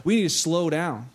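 ordinary talk

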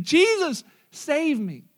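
high, loud cry for help on Jesus; desperate cry. Acted. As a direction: call out like a sinking man grabbing for rescue. Begins to sink.

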